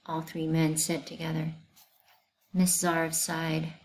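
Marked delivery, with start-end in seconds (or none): none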